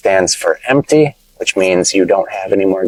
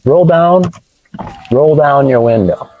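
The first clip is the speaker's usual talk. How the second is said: someone asking to roll down; 6 semitones higher than usual. Retold or imitated. Teacher voicing a driver asking a friend to roll down the window. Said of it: "but we still say".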